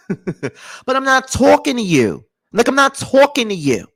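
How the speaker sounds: high, exaggerated New York delivery; New York accent. Imitating New Yorkers for laughs, high mock New York accent. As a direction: round out "talking" like a New Yorker. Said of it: "I love the way New York people talk".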